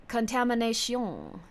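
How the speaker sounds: slow, stretched mock French accent; exaggerated French pronunciation. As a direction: draw the word out in French fashion. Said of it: "I will not pronounce it that way".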